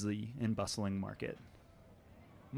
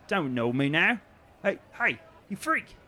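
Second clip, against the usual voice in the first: loud shout climbing much higher to a shriek; angry jeering shout; 8 semitones higher, 7 dB louder than usual. Acted. Guard yells at Barb while throwing stones, loud rising shout. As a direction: yell it as the stones start flying.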